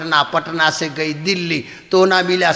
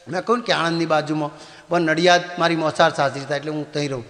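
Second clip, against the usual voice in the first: ordinary talk, nothing marked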